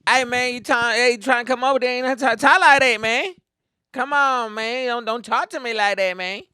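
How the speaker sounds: high, loud swaggering street voice; imitating friend's talk. Friend warning someone not to talk like that, high mimicked street talk. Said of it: "I had a friend who used to talk like that".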